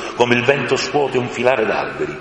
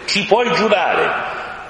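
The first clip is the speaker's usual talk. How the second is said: high, slow, cheeky crow voice; a saucy crow teasing; 11 semitones higher, much slower than usual. A narrator's reading. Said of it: "una cornacchia impertinente aggiunse". (cornacchia impertinente, acuta e lenta)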